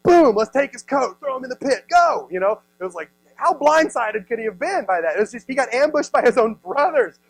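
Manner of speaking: ordinary delivery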